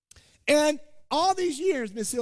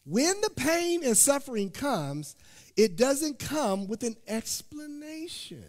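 ordinary delivery

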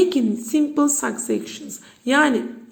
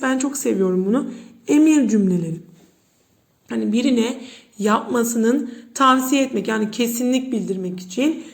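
ordinary delivery

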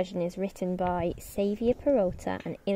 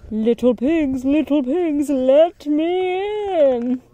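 louder sly wolf call, the end slow; big bad wolf; 6 semitones higher, 5 dB louder, slower than usual. Wolf calling at the stick house, sly and slow. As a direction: drag out let me in like a wolf.

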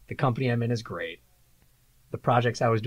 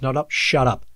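louder retold interruption; director overruling; 3 semitones higher, 6 dB louder than usual. Director overruling the producer, retold interruption. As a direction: imitate a director shutting someone up. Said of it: "And then the director goes".

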